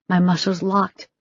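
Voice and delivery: ordinary delivery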